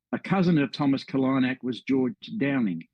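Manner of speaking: usual speaking voice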